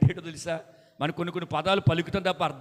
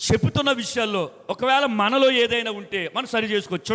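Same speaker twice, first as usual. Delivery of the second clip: normal talking voice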